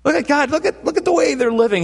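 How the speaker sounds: very high, eager boasting; proud big brother. Acted. Jesus urging God to look at how they live, excitedly proud. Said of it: "Jesus just goes".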